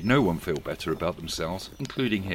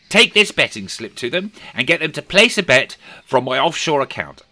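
eager; 7 semitones higher, 7 dB louder than usual. A narrator's reading. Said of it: "said Scrooge".

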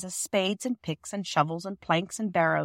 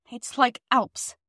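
slow, higher, out of breath; a light breathless climb voice; 4 semitones higher, slower than usual. A narrator's reading. Girl climbing the steep hill, mildly breathless. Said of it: "said Bobby breathlessly".

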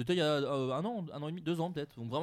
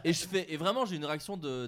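ordinary delivery

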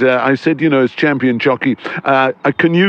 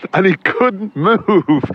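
words broken by a laugh; slow, chuckling mid-sentence; laughing through words; 6 semitones higher, much slower than usual. Real, not acted. Laughing while telling prank.

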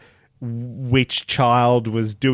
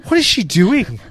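disbelief; 9 semitones higher than usual. Real, not acted. Baffled rant about bad accent.

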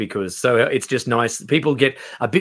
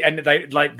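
ordinary delivery

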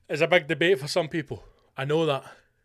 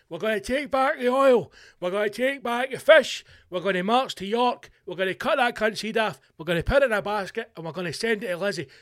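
higher, louder mock campaign speech; rally speech; 5 semitones higher, 3 dB louder than usual. Podcaster as mock candidate rousing voters, rally shout. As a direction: thunder promises at a rally crowd.

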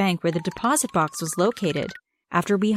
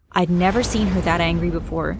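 ordinary delivery

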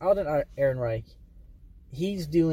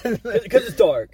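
slow, louder, dragged-out insistence; insistent; 7 semitones higher, 8 dB louder, slower than usual. Real, not acted. Insisting again to his friend that the movie is dark. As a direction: lean hard on it, mock exasperated. Repeating complaint emphatically, louder.

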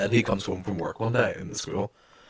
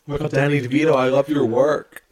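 hammed-up starstruck gush; Mean Girls quote; 5 semitones higher, 4 dB louder than usual. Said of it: "There was a Mean Girls quote".

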